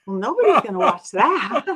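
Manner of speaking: retold dismissal, breaking into laughs; laughing disbelief. Reacting to the show's silly name, laughing disbelief. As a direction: scoff through laughter.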